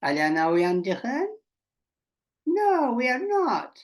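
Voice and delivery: usual speaking voice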